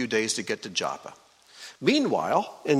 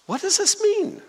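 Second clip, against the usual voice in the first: high-pitched puzzled question; mock bewilderment, pitched very high; acted perplexity. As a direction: ask it in baffled disbelief, voice way up. Voicing Peter's perplexity at the vision.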